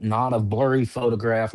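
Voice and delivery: usual speaking voice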